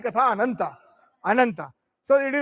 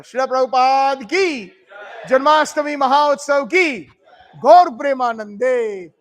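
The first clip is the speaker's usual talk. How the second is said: call-out cheers; 2 semitones higher, 6 dB louder than usual. Real, not acted. Priest calls out glories to the assembled devotees, loud. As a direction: ring out each cheer loud to the hall.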